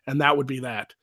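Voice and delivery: ordinary talk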